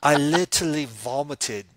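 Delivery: slow, loud, scathing British judge; hammed-up withering talent judge; Simon Cowell. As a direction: deliver a drawn-out British put-down with big pauses. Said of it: "the Simon Cowell".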